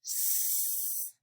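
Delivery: a long unvoiced hiss on "S-"; "S-" as a drawn-out hiss; hissed s sound. Shown as a demonstration. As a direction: stretch out the hiss of "S-" with no voice. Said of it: "sound demo before the sentence".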